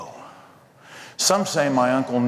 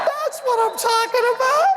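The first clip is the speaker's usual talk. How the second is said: cheering crowd. Retold or imitated.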